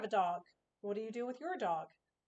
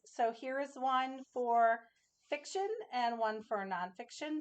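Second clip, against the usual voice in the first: usual speaking voice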